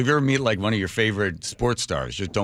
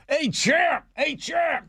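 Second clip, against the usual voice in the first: much higher, stretched-out hollering; dad yelling nicknames; much slower than usual. Retold or imitated. Dad chasing Frazier down the sidewalk, high excited yelling. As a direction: shout every nickname at the champ. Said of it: "Throwing every name at him".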